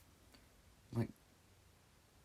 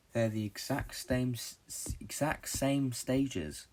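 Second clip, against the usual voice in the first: exact same stages drawn out slowly and louder; slow emphatic; 3 semitones higher, 4 dB louder, much slower than usual. Real, not acted. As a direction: stretch out each word of exact same stages. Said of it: "slowed emphatic delivery".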